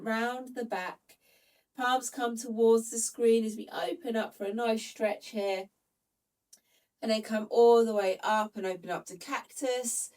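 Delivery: normal talking voice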